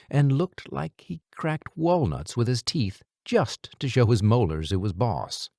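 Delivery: plain reading voice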